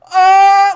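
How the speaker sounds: sudden high, loud shriek of pain; stabbed scream. Retold or imitated. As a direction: cry out as the machete hits. Brother stabbed by machete.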